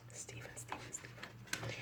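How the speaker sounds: breathy whispered chant of the name; eerie whisper. Pretend. Whispering the creator's name eerily. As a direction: whisper it like a ghost calling.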